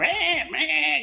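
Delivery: high bleating goat call; imitating goats. Owner mimicking his goats begging for water, high bleat. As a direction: bleat the words like a hungry goat. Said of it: "and they'll start".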